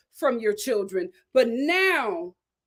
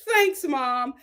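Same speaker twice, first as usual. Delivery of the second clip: ordinary delivery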